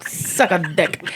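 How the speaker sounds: high, laughing disbelief. Real, not acted. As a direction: laugh through the comeback. Laughing at friend's tease.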